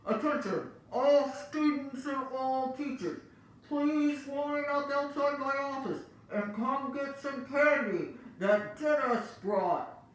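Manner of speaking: high, loud, slow loudspeaker call; excited announcement. Acted. Principal announces candy over loudspeaker, high and loud.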